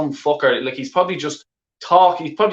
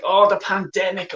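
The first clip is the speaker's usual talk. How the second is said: higher, sarcastic sneer on "pandemic"; mocking; 6 semitones higher than usual. Shown as a demonstration. Fan mocking the club owner's pandemic excuse, sarcastic. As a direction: throw "Oh, the pandemic" back at him with scorn.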